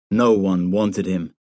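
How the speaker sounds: ordinary delivery, nothing marked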